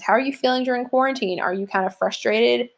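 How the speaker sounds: ordinary delivery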